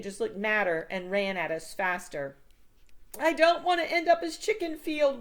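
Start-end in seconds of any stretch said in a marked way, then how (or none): 3.2-5.2 high, louder wail. A narrator's reading. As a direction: wail in panic against the fence. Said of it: "wailed Sam".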